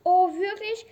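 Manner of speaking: loud, high "Oh, wirklich?" full of hope; the princess jumps at the frog's offer, loud and thrilled; excited. Acted. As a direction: leap at the offer. Frog offers to fetch ball.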